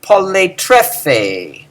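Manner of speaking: poletrefe very slow, poly unaccented; very slow poletrefe with the stress near the end; slow model word. Shown as a demonstration. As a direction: drawl poletrefe syllable by syllable. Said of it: "lots of eating".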